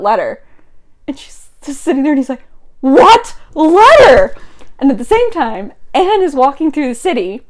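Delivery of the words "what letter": very slow, loud, high-pitched shock; dramatic disbelief. Acted. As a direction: stretch both words out in loud astonishment.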